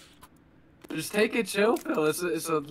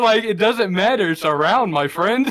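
ordinary delivery

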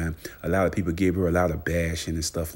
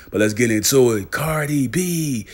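louder, higher kick-off; hype transition; 7 semitones higher, 8 dB louder than usual. Pretend. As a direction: launch the next story. Switching to next news item.

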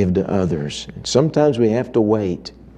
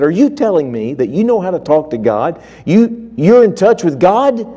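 higher and louder, climbing in disbelief; can't believe what he hears; incredulous, rising sharply; astonished disbelief; 8 semitones higher, 4 dB louder than usual. Retold or imitated. Stunned manager questions stranger.

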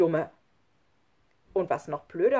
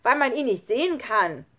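loud, higher, insistent; angry scolding; 5 semitones higher, 8 dB louder than usual. A narrator's reading. Schlunz says Jesus is invisible, angry. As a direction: press the objection heatedly. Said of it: "schimpfte jetzt auch Schlunz".

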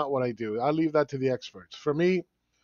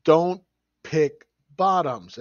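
emphatic warning; 2 semitones higher, 4 dB louder, much slower than usual. Retold or imitated. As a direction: hammer home the warning, drawn out. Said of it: "I said".

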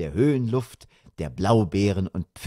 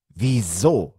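indignant question from the father; a touch of affront. A narrator's reading. Father questioning the traffic policeman, indignant. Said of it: "fragte der Vater entrüstet".

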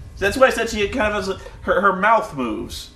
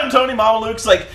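ordinary talk, nothing marked

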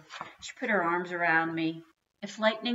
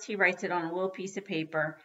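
ordinary delivery

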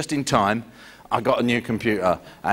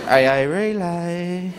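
slow, drawn-out name of the service; a hint of a phone call; AA Relay call; 5 semitones higher, much slower than usual. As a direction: say it slowly like reading the number.

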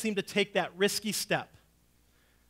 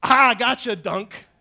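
playful dunk; 3 semitones higher, 10 dB louder, slower than usual. Pretend. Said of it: "I'm not gonna go".

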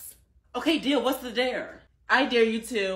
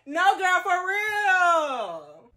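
normal talking voice

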